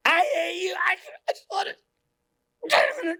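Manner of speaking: high, crying shriek of pure hate; possessed screaming hatred. Retold or imitated. Programmed person turning on you, shrieking and crying. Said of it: "Like Matrix Smith".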